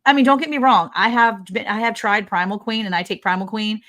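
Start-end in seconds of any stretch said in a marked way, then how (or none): none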